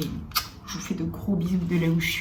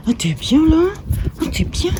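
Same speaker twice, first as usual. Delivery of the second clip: talking to the dog; 11 semitones higher, 10 dB louder than usual. Real, not acted.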